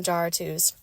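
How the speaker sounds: "jar or twos" said in place of Jarritos; deliberate mispronunciation. Retold or imitated. Explaining the playful skit, deliberately mispronounced "jar or twos". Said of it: "incorrect way that I could pronounce".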